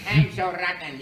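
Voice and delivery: loud, laughing character voice; gloating cartoon fool. Fool owning up to being rotten, loud laughing gloat. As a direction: gloat through a cackle.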